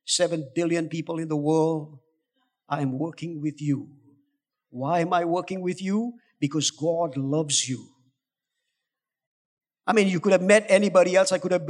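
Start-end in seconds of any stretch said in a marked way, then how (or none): 2.7-7.9 quiet sincere assurance; gentle demo line. Shown as a demonstration. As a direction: say it softly, as if to one person. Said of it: "a very simple way of doing this is just to say".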